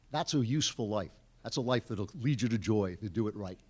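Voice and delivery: normal talking voice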